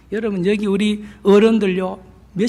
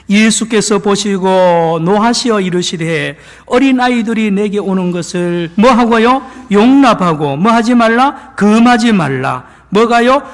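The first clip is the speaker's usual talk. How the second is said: louder, emphatic scripture read with call-and-response; preaching scripture; 5 dB louder than usual. Acted. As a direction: proclaim the verse and quiz the congregation. Reads and quizzes Mark 10:14. (힘주어 성경을 선포하듯)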